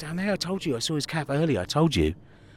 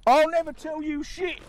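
high defiant voice; 7 semitones higher than usual. Acted. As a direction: taunt them from above.

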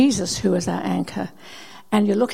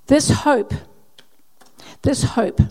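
loud, ringing emphasis; emphatic proclaiming; 3 semitones higher, 4 dB louder, slower than usual. Acted. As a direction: proclaim it to the room. Preacher reading scripture emphatically.

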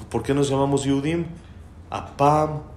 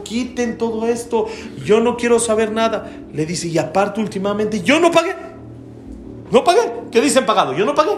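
high, loud indignant protest; gritos de enojo imitados; 11 semitones higher, 4 dB louder than usual. Retold or imitated. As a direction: act out a customer yelling in outrage. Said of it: "le empieza a gritar y a gritar". (protesta indignada a gritos)

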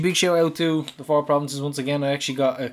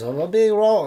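put-on lightly done Athlone accent, pitched up; a hint of a midlands Athlone lilt, raised; 7 semitones higher, 3 dB louder than usual. Security guard in Athlone accent, mock impression. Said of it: "excuse the accent".

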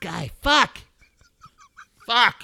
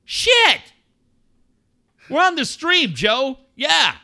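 higher, heated rant at Joe; pretend scolding; 8 semitones higher, 4 dB louder than usual. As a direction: chew him out as Steve, rising in pitch. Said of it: "if I'm Steve, I'm like".

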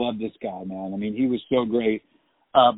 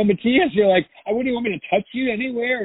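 mock exasperation; 11 semitones higher than usual. Mock exasperation at fragile fight partner.

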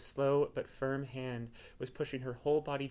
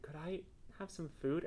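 soft, slow, higher wheezy plea; sigh-wheezed; 4 semitones higher, 6 dB quieter, slower than usual. A narrator's reading. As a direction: sigh the request out weakly. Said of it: "He sigh wheezed and said".

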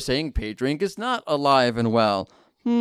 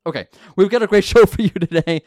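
giggling through the intro line; laughing; 4 semitones higher, 5 dB louder than usual. Real, not acted. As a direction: breaks into a laugh mid-sentence. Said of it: "It's like my least favorite part of the show".

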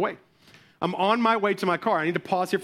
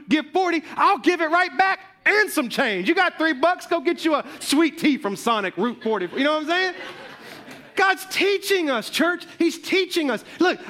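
fervent preaching; 8 semitones higher than usual. Real, not acted. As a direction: preach it home to the church. Preaching at full force.

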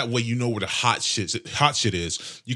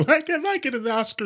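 much higher, whiny mock sob; mock crying wannabe actor. Mocking would-be actors crying at home, high whiny fake sob. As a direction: whine like a hopeless wannabe actor. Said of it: "sitting home crying like".

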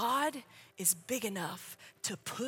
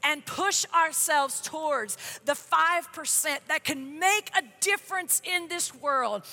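louder, rising fervent preacher's prayer; 2 semitones higher, 4 dB louder than usual. Acted. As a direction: pray over the room with growing force. Leads a closing prayer.

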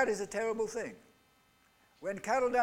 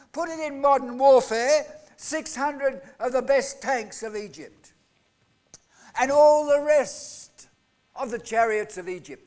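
louder, higher storytelling sermon; preaching at full force; 6 semitones higher, 7 dB louder than usual. Acted. Preacher dramatizing Pharaoh's army for the congregation, in loud forceful preaching. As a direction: preach the Red Sea chase with rising force.

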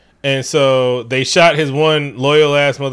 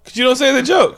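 much higher playful protest; mock squeal; 11 semitones higher, 3 dB louder than usual. Real, not acted. Whining to cohost in playful protest. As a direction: squeak out the complaint.

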